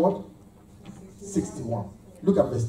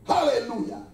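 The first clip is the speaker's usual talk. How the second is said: ordinary talk, nothing marked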